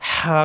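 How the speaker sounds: হা on warm breath from mid-throat; warm-air throat H. Shown as a demonstration. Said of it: "গরম বাতাসের সাহায্যে".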